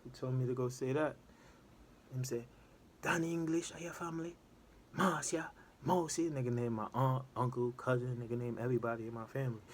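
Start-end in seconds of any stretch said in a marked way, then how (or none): 3.0-6.3 porter listing names in a light Jamaican voice; lightly voiced Jamaican porter rattling off names; Jamaican accent. Porter naming family in patois.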